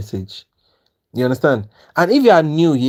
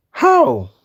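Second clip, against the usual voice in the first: a sudden much higher, stunned How; shocked; 11 semitones higher than usual. Real, not acted. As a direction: gasp the word out in disbelief. Reacting to a huge loss.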